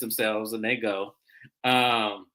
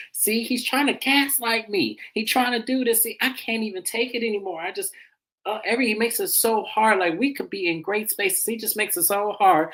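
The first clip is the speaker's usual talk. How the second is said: mock Drew venting; 8 semitones higher than usual. Drew venting to her mama in the car, higher whiny mock. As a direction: play her as put-upon and fed up. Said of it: "she over there talking about some".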